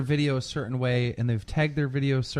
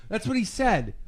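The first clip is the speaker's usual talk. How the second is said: ordinary talk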